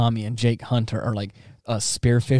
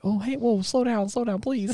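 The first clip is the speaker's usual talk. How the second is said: imitated panicky peacemaker; retold alarmed pleading; 12 semitones higher, 3 dB quieter than usual. Voicing a character calming another.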